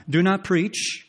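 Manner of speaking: high, loud scornful command; mocking quote. Preacher mimicking people silencing the prophet, high and loud. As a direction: hurl their order back in scorn. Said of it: "thus they preach".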